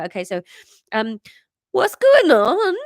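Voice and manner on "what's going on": put-on high, loud, drawn-out boy's voice; younger Welsh boy. Younger brother arrives bleary-eyed, high Welsh boy's voice. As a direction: pipe up slowly as a Welsh kid. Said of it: "this one's a bit younger".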